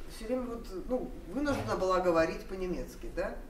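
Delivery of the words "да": ordinary talk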